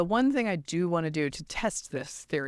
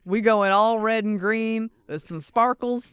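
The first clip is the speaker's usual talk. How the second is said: showy drawling Southern voice; brash Southern brag; Southern accent; 3 semitones higher, 4 dB louder than usual. Retold or imitated. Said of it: "the South is just like".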